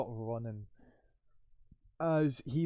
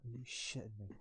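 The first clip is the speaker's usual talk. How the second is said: muttered to oneself, much softer; muttering; 10 dB quieter than usual. Acted. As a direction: grumble it softly under your breath. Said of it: "you kinda mutter".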